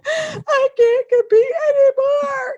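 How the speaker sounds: high, slow tearful confession; crying. Retold or imitated. Wife tells husband through tears, high and slow. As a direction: break down as you say it. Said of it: "My husband sees me crying".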